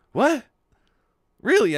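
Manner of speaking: high, loud startled what; taken aback that someone cares; a loud high double take; surprised. Acted. Shinji surprised by concern.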